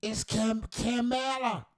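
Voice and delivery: high, sassy correction of her name. Retold or imitated. A lady correcting people on the name, sassy and high. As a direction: roll your neck and correct them. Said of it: "lady out there rolling her neck".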